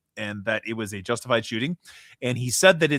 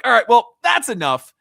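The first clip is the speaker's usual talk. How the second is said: fed-up outburst; 10 semitones higher, 10 dB louder than usual. Retold or imitated.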